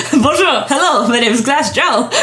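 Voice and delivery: hammed-up French boxer, breaking into laughter; Glass Joe. Host quoting a comedy song, laughing French boxer voice. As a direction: play a cartoon French boxer through giggles. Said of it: "he's Glass Joe".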